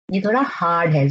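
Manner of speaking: ordinary delivery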